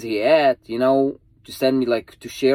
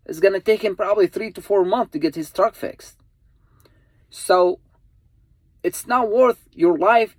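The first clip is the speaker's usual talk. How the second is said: higher, pressing appeal to drivers; earnest pleading; 7 semitones higher than usual. Real, not acted. Trucker begging fellow drivers to pull over, earnest raised plea. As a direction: plead with them to stay safe, voice climbing.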